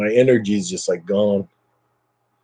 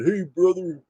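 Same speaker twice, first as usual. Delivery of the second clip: Hulk Hogan impression; 7 semitones higher, 4 dB quieter than usual. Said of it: "he always said".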